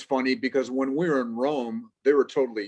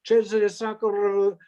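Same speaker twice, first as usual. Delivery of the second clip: much higher-pitched imitation of excited callers, stretched name; villagers phoning around; 9 semitones higher than usual. Villagers on cell phones seeking relatives, high-pitched imitated inquiry. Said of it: "they start saying".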